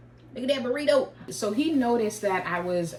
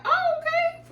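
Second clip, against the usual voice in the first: very high, strained polite "okay"; put-on high-voiced reply; high-pitched okay; 3 dB louder than usual. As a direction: squeak out an uneasy acknowledgement. Acted reaction while over it.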